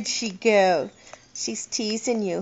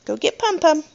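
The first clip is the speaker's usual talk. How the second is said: put-on reading to a toddler; 8 semitones higher, 4 dB louder than usual. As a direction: call out to a toddler, sing-song. Coaxing toddler to call dog.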